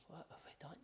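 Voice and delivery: whispered to himself in dismay; a hushed whisper of regret; whispered worry. Retold or imitated. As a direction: breathe it out under the breath, stunned. Retelling worried drive home.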